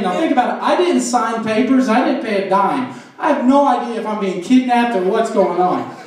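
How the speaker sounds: ordinary delivery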